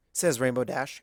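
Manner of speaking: plain reading voice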